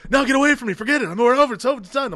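high, loud mock breakup yell; shouting breakup. Reese Witherspoon shouting at David to leave. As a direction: yell it like a girl dumping her boyfriend.

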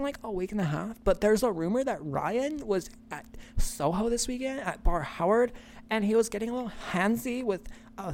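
high, soft, gossipy Valley Girl impression; fake Valley Girl accent. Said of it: "fake Valley Girl talk".

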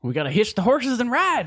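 rally the posse like a cowboy. Pretend. Cowboy sign-off.

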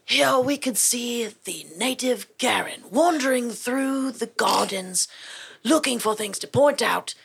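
slow British nature-documentary narrator; David Attenborough. Acted. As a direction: narrate wildlife in a measured British accent. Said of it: "the David Attenborough, like, impression, impersonation".

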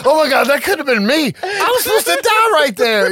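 mock alarm, pitched high; high, excited imitation of someone shaken; shocked realization. Acting out John's near-miss for the co-host, mock shocked.

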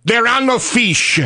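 put-on high, slow French-accented despair; mournful French cry, drawn out; french chef. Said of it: "final talking mime".

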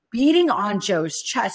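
usual speaking voice

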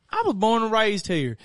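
thick Southern country twang; country accent. Retold or imitated. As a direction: answer in a hammed-up country accent. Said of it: "I was talking in a country accent".

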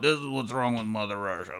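soft slurred grumble; mumbling homeless man. Retold or imitated. Homeless man griping on a Russian bus, drunken mumbling. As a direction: mumble it like a rambling vagrant. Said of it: "he's mumbling about".

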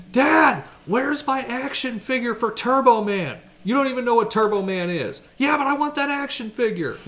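put-on whiny kid voice, high and loud; demanding child calling Dad; yelling across the room. Kid demanding a lost toy.

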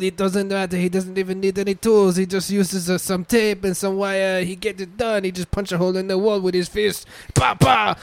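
stumbling mock Portuguese salesman, building to a pa pa cry; fake Portuguese accent. Pitching a fist-punching electrician, fake Portuguese accent. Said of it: "racially ambiguous fucking accent".

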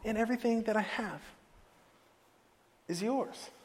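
hushed, earnest appeal with pauses; tender reassurance. Acted. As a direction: coax gently, phrase by phrase. Father reassures and pleads with son.

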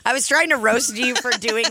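normal talking voice

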